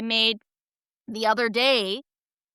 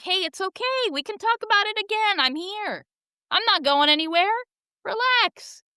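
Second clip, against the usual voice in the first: higher, gentle reassurance to an elder; reassuring; 9 semitones higher than usual. Shown as a demonstration. Host modelling what to say to an aging parent, reassuring. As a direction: soothe them like a worried parent. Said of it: "something more like".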